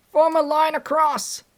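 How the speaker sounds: high, loud order; shouted command. A narrator's reading. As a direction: bark the order across the street. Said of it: "he cried".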